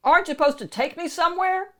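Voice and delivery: fast, high, exasperated question; impatient. Acted. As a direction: rattle it off impatiently. Shrek impatient with donkey.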